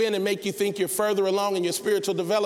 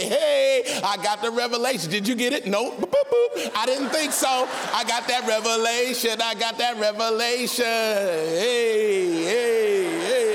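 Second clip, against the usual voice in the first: proud bragging Peter; 5 semitones higher than usual. Acted. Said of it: "Imagine the pride on Peter".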